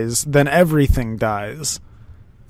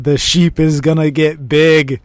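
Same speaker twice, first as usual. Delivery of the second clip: loud giddy glee; wild unhinged ranting; 3 semitones higher, 6 dB louder than usual. Real, not acted. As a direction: gloat gleefully about it. Gleeful over the buff.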